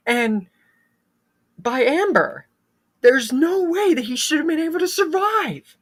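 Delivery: can't believe his friend is alive; stunned disbelief. A narrator's reading. Narrator telling how Mark cannot believe Dewey lived, stunned and high.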